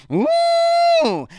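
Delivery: high shouted train horn hoot. Retold or imitated. Steaming mad like a cartoon, a high shouted horn hoot. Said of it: "the train horn go like".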